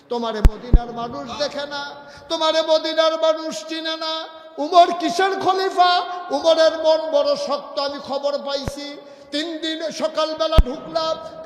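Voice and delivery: high, very loud accusation; loud, high-pitched scolding of an absent ruler; angry tirade. Acted. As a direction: berate him at full preaching volume. Woman angrily berates Umar's rule.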